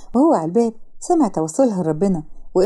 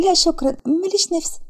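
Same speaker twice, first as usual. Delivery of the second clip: lightly voiced shy girl; 8 semitones higher than usual. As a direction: play the shy tearful girl, timid. Sara voiced high. (بنت خجولة بصوت أعلى وأهدى)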